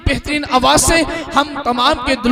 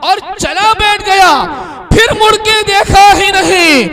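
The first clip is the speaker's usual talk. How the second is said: higher, louder and slower, building to a cry; recited verse, building; 7 semitones higher, 6 dB louder, slower than usual. Acted. Announcer driving the verse home to the gathering, high and drawn out. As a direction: stretch the lines out and lift them over the crowd.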